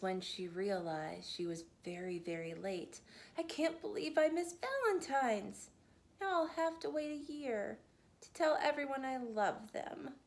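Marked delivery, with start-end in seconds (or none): 3.4-10.2 Ruby's higher, upset voice; a little girl crushed to have missed the day; dismayed, higher voice. Acted. Ruby upset at oversleeping, higher voice.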